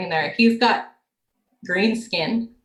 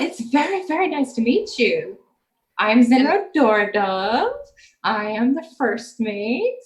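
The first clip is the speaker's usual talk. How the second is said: flirty elf voice; 4 semitones higher, 3 dB louder, slower than usual. Acted. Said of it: "the elf called Zinro is like".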